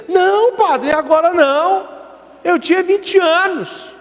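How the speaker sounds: put-on high, frail-sounding old woman; old lady voice. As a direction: play the little old lady answering the priest. Priest voices old lady confessing.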